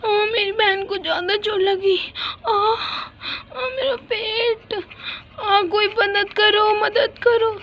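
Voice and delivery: high, slow, pleading cries; injured child pleading; pained cries for help. Acted. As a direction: beg for help while hurt. Injured girls calling for help.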